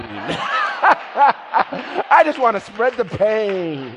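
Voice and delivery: slow, drawn out through laughter; laughing repeat. Real, not acted. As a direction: savor the joke while laughing.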